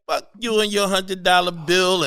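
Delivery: indignant retold outburst; defiant comeback. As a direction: fire back at a heckler, mildly acted. Comic retells defiant comeback to audience.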